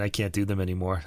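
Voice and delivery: ordinary talk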